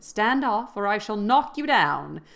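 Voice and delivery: a loud warning, pitch pushed up; threat. A narrator's reading. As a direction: warn her off with menace. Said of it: "this warning".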